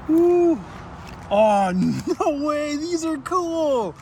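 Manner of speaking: high, slow, amazed whoop; delighted whoop. Real, not acted. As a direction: gush over the plates, stretched out. Delighted by new plates.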